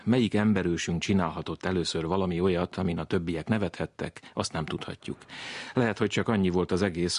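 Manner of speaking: ordinary talk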